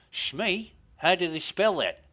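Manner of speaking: mock-baffled Australian DJ; Australian presenter questioning a caller; Australian accent. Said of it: "my Australian accent's shit".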